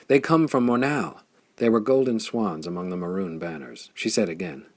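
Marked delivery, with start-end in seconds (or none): 0.1-1.2 higher and louder call of alarm; excited cry. A narrator's reading. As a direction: cry it out across the plain. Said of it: "and cried".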